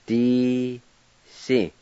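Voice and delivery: "Di si" split into two syllables; "Di si" one syllable at a time. Shown as a demonstration. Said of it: "I'll go back and pronounce them".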